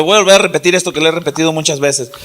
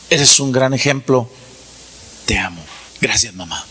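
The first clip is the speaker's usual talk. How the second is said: put-on child quietly telling mother she's loved; tender thanks; 8 semitones lower, 3 dB quieter than usual. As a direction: murmur it like a grateful child. Child thanking mother, voiced by preacher.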